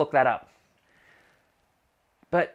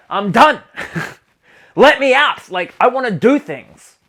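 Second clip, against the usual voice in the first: loud, high, fed-up I'm done with a laugh; impatient client; 7 semitones higher, 9 dB louder than usual. Retold or imitated. Client fed up with her depression homework, loud and laughing. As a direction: play the bored client bursting to get out. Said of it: "They're like".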